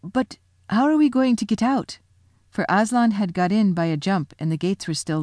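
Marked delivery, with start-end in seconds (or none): 0.0-2.0 higher, anxious faun voice; worried faun question; a light faun's voice, a touch anxious; Tumnus asking. Acted. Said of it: "first said".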